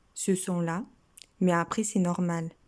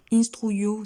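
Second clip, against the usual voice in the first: "Instruyo" louder, weight on the last O; "Instruyo" as a model word, stressed at the end; stress on final O; 3 semitones higher, 7 dB louder than usual. Shown as a demonstration. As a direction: punch out "Instruyo", landing on the O. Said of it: "donc c'est accent sur le O".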